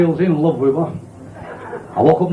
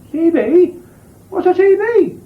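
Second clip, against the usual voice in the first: high, baffled woman's voice; a naive woman asking about the stereo; puzzled woman; 14 semitones higher, slower than usual. Acted. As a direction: squeak the question in disbelief.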